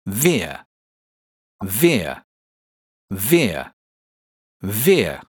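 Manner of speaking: "Veer" said like near, for repeating; model syllable. Shown as a demonstration. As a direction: clear drill model "Veer". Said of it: "Repeat after me".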